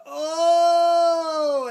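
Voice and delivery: loud, high imitated cry of relief; retold scream. Student overwhelmed by the teacher's promise, crying out loudly. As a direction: burst out with a high wailing cry. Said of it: "she screamed out".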